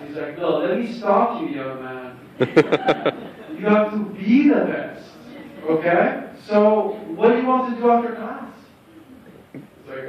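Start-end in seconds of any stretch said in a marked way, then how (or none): 0.4-8.4 Tommy voice. Retold or imitated. Tommy lecturing young Greg.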